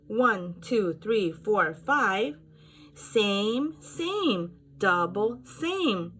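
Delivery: loud, high sing-song matching; lively counting. Shown as a demonstration. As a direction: count along with energy for kids. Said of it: "teacher matching counts aloud".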